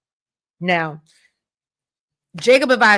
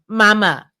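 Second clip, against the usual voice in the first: louder, firmer "mama"; acted, exasperated louder "mama"; insistent calling; 6 dB louder than usual. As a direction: call "mama" with more force. Acted second, more insistent call.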